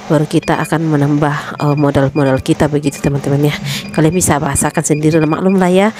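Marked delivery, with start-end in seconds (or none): none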